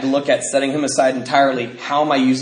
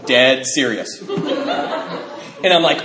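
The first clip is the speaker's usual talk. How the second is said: normal talking voice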